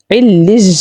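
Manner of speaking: علج with a hard j sound for the doubled ya; common mispronunciation. Shown as a demonstration. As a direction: turn the y into a buzzing j in علج. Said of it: "تو وہ کس طرح پڑھ رہے ہوتے ہیں".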